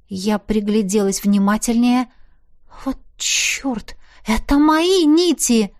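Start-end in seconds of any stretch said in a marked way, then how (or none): 2.8-3.9 shocked whisper. Acted. Sees her own threads, shock. 4.3-5.7 louder, higher, slower dismay; alarmed exclamation. Acted. Realizes the threads are hers.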